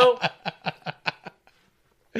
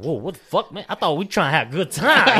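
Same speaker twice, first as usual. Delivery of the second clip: loud, higher-pitched mock protest; indignant protest; 5 semitones higher, 8 dB louder than usual. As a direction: act out a taken-aback objection, building louder. Acted protest to drunk friend.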